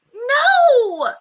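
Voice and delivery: put-on high, loud child's scream; a little girl's shrieking refusal. A narrator's reading. Ramona refuses her mother, screaming high and loud. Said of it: "screamed Ramona".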